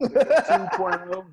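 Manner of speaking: high, laughing blurt; answering the GPA question through a laugh; laughing exclamation. Real, not acted.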